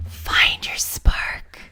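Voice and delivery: slow breathy ASMR whisper. Shown as a demonstration. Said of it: "Yes, A S M R".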